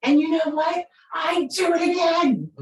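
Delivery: high-pitched, imitated teenage defiance; defiant daughter. Daughter answering her victim-playing mother, mock defiant. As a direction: throw it back at mom, high and unrepentant. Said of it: "she put her hands on her hip and she shook her head".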